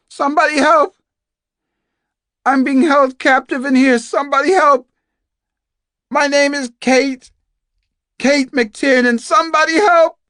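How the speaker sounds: desperate high yell, carrying through walls; screaming for help. Acted. Captive woman announcing herself to anyone in earshot, screaming. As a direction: scream at the top of your voice to be found. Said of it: "screaming at the top of her voice".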